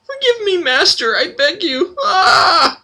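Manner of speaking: very high, crying plea for mercy; begging in pain. Acted. Frail slave begs his master under the cane, crying. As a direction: wail like a beaten slave.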